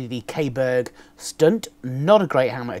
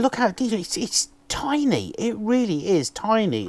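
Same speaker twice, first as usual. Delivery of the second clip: delighted; 9 semitones higher than usual. Real, not acted. Vlogger showing viewers the tiny recorder, delighted and high. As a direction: pitch jumps high, marvelling at the small size.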